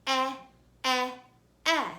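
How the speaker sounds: EH said as short E in edge; short E sound. Shown as a demonstration. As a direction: say EH like the start of edge. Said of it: "Say it with me".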